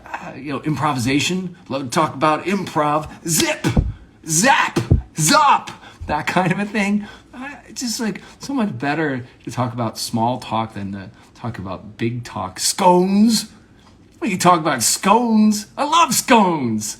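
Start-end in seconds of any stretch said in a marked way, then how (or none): 3.3-5.7 high, punchy shouted bursts; improv game calls. Shown as a demonstration. Reciting improv warm-up words, shouted high and punchy. 12.6-17.0 loud, high, delighted outburst; gushing excitement. Acted. Gushing to listeners about scones, loudly excited.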